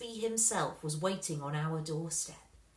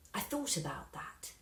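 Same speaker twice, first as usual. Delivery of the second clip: whispered; 7 dB quieter than usual. A narrator's reading. Narration line whispered.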